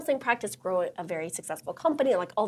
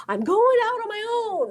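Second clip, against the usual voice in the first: excited declaration; 13 semitones higher, 6 dB louder than usual. Retold or imitated. Mocking a bold leap.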